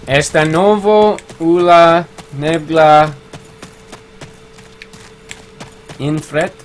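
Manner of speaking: slow, French-sounding read of "Esta nova ula nevgla infret"; mock French reading. Shown as a demonstration. Streamer reading a viewer's Spanish comment, slow and French-sounding. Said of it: "I read things sort of French".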